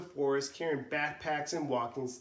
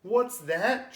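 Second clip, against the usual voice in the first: trembling; 6 semitones higher, 7 dB louder than usual. Acted. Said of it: "trembles Jason".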